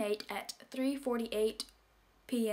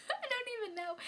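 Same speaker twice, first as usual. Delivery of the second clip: embarrassed giggle-voice; 13 semitones higher, 5 dB quieter than usual. Acted. Embarrassed after the swoon.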